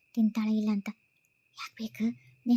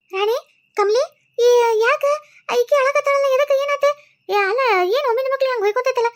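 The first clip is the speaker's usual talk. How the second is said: put-on a much higher, louder old granny voice, alarmed; startled old woman; 9 semitones higher, 4 dB louder than usual. Grandmother woken by the wailing asks what happened, alarmed and higher. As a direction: demand to know why everyone is wailing.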